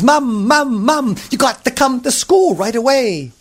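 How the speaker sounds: high, pleading repeated calls; urgent boy. A narrator's reading. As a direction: beg urgently as a panicked kid. Benjamin urgently calls his mother.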